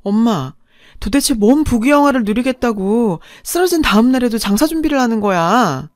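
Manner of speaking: narrated daughter yelling at her mother; angry outburst. A narrator's reading. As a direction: scold Mom in exasperated disbelief. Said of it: "소리를 버럭 질렀는데요".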